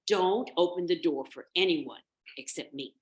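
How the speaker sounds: emphatic motherly instruction; mother goat's warning. Acted. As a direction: warn the little ones firmly. Nanny goat warns her kids.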